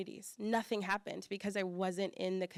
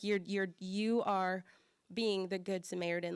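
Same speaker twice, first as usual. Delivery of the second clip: ordinary delivery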